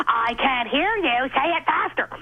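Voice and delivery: drawn-out, bullying mock taunt. [name] torments the stuttering girl at the club, mocking taunt. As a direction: needle her slowly, rising at the end.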